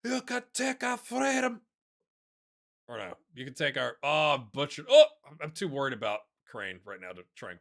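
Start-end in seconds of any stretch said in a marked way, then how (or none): 0.0-1.6 high, slow rousing battle cry; mock epic Braveheart speech; Braveheart quote. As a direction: declaim it like a film hero rallying troops. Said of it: "Mandatory Braveheart viewing".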